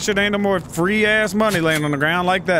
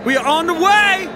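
high, raised announcement; excited call; 10 semitones higher, 4 dB louder than usual. Real, not acted. Player announcing the trip, excited. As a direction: call it out high as you set off.

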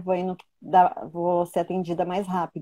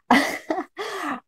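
little laugh; 12 semitones higher than usual. Real, not acted. Laughs at not crying.

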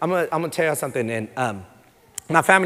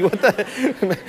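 laughing disbelief; 6 semitones higher than usual. Real, not acted.

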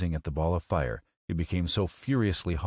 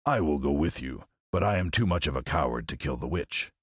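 low lion voice; cowardly Lion; 3 semitones lower than usual. Acted. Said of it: "said the Lion".

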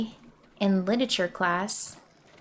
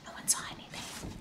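whispering; 15 dB quieter than usual. Real, not acted. Whispering after mistake.

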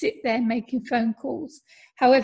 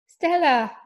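bright, higher, louder call of her name; pleased greeting; 4 semitones higher, 5 dB louder than usual. A narrator's reading. Boyfriend answering her call, pleased to hear her. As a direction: glad surprise at her call. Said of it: "He sounded pleased to hear from me".

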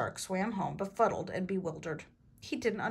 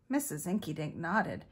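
plain reading voice

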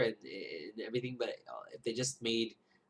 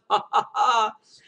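loud high-pitched burst of laughter; cackling laugh, high and loud; 12 semitones higher, 13 dB louder than usual. Real, not acted. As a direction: let a big high laugh out. Laugh after laugh laugh.

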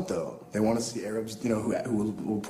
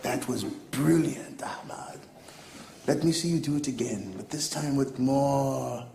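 slow, drawn-out higher director voice, dropping to a whisper; imitated gushing director; 5 semitones higher, slower than usual. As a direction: savor each word like an enraptured director. Director going nuts, voiced in story.

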